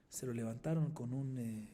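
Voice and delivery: ordinary talk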